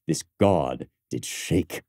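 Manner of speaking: "god" drawn out, slow and low; slow stress on god. Shown as a demonstration. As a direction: linger on "god" with scorn. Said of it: "What a line".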